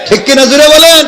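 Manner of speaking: high, loud demand for agreement; shouted crowd prompt. Real, not acted. Preacher asking crowd to agree loudly, shouted. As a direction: press the congregation to answer at full voice.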